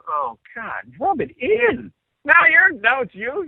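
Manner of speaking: high-pitched mock anguish; exasperated whine. Singer reacting as both friends rub it in, exasperated whine. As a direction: complain high, rising as it goes. Exasperated at being teased twice.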